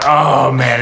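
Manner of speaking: high, loud worried groan; dad dreading the drop; mock dread. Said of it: "I'm, like, going".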